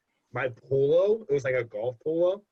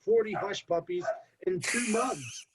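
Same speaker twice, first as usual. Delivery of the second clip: incredulous listing; 8 semitones higher than usual. Real, not acted. Listing the huge order.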